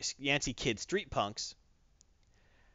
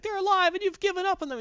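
very high, loud accusing yell; Johnny shouting at Ben before flying off; anguished shout; 5 dB louder than usual. Retold or imitated. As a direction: hurl it at him as you storm off. Johnny storming off.

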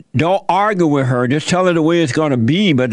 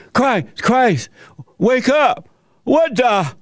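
high frantic plea to wake him; retold panic, voice pitched up; panicked disciples; 8 semitones higher, slower than usual.

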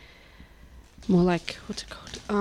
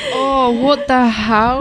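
drawn-out, higher exclamation; shocked disbelief; 5 semitones higher, slower than usual. Real, not acted. Co-host hears about brains fried in butter, shocked. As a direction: stretch it out, appalled and incredulous. Reacting to a gruesome cannibal story.

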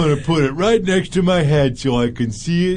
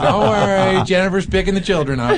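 lightly voiced proper mom, a bit higher; faking a polite excuse to the principal; mock prim parent; 4 semitones higher than usual. Telling the principal in a prissy voice. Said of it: "I'm like".

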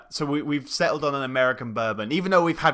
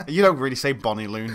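ordinary talk

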